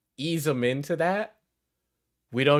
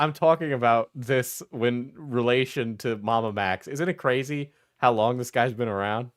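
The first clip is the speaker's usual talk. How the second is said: ordinary talk, nothing marked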